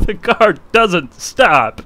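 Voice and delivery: laughing through the words; amused disbelief, slow. Real, not acted. Laughing at the crash.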